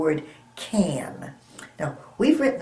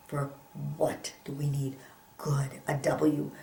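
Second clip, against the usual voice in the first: soft unvoiced whisper to the class; 3 semitones lower, 10 dB quieter than usual. Real, not acted. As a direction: whisper it like a secret.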